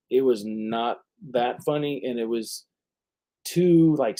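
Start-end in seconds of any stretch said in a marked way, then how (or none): none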